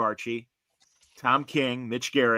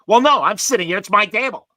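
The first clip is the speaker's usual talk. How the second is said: louder, higher mock huffy protest; a petty man defending his seat; imitated indignant claim; 8 semitones higher, 10 dB louder than usual. Guy insists the table is his, hammed-up indignant.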